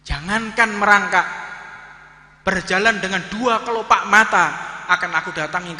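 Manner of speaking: loud, high, hammering each phrase; emphatic preaching. Real, not acted. Preacher echoing the vow to his congregation, loud and emphatic. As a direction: drive the vow home to the crowd.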